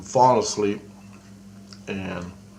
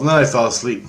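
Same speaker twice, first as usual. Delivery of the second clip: ordinary talk, nothing marked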